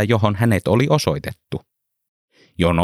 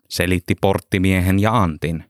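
ordinary delivery

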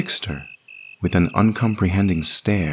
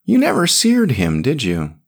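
teasing man voice; 8 semitones higher, 4 dB louder than usual. Acted.

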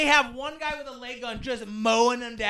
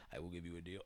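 very low, very soft demon voice; 25 dB quieter, faster than usual. Retold or imitated. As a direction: rumble it like a devil.

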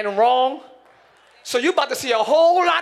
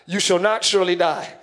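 lightly voiced enemy, lower pitch; enemy's voice; 6 semitones lower than usual. Enemy contradicting God's word about the tree, lightly acted low voice. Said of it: "the enemy's voice".

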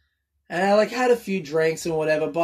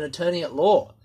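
normal talking voice

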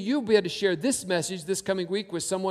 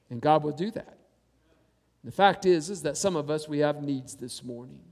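usual speaking voice

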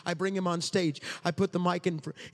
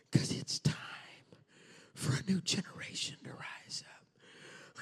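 much softer, near whisper; hushed sermon; 6 dB quieter than usual. Acted. Preacher quietly pressing the message home, hushed. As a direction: draw them in with a hush.

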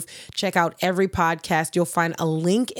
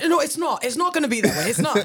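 higher, playful put-on British accent; mock British accent; 7 semitones higher, faster than usual. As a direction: pretend to protest in a jokey British accent. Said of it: "you wanna pull out your, your British accent".

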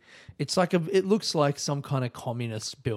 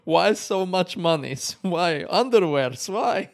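higher, louder, slow mocking questions; imitating Greek agent; 6 semitones higher, 6 dB louder, slower than usual. Agent teases the traveller over declared underwear, mock Greek accent. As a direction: drag out each why in a put-on Greek accent.

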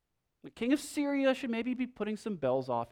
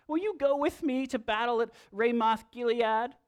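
high, whiny king voice; Ahab; 8 semitones higher than usual. Acted. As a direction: whine the complaint way up high. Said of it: "this is my whiny Ahab voice".

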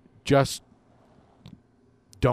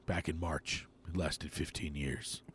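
low, slow hushed storytelling; hushed creepy legend telling; 8 semitones lower, 13 dB quieter than usual. Pretend. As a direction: lower and slower, like a spooky legend. Grandpa telling legend of coronavirus.

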